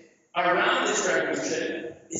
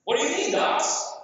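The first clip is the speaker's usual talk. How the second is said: lightly voiced client; 9 semitones higher, 4 dB louder than usual. Client answers consultant's question.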